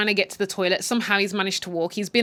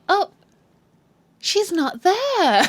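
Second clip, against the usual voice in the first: high, slow, fake-innocent sing-song; mock sweet voice; 11 semitones higher, 5 dB louder, much slower than usual. Mother taunting the helpless man, high and slow. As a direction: pretend she's innocently surprised he's missing. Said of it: "she's like".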